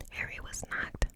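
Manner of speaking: usual speaking voice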